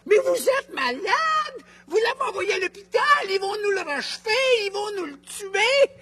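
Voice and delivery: outraged yelling. Retold or imitated. Grandmother scolding family for sending him to hospital, shrill yelling. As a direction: scream at them in horrified indignation. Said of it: "Elle criait".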